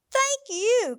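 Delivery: a burst of happy thanks; delighted thanks. Acted. Grateful animal thanks gift.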